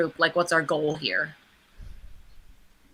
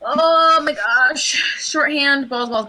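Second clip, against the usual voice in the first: slow, drawn-out panicked gasp; freaking out; 6 semitones higher, much slower than usual. Real, not acted. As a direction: stretch it out in alarm. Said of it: "I'm freaking out".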